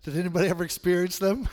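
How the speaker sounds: ordinary delivery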